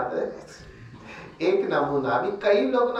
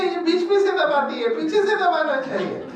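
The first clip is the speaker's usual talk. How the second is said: fussy husband; 7 semitones higher than usual. Retold or imitated. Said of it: "मैंने उसको बोला".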